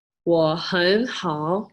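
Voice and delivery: very slow "我很好", each syllable stretched; Mandarin pronunciation. Shown as a demonstration. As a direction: draw out every tone of "我很好". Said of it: "you could say".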